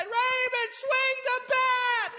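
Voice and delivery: high, drawn-out crowd yell; screamed cheer. Retold or imitated. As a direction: holler it from the stands. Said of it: "Everybody just screamed".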